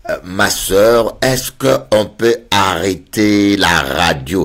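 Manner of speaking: slow, drawn-out plea; slow pleading. Retold or imitated. Asking the sister to stop talking, slow and tired. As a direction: beg her to stop, slowly.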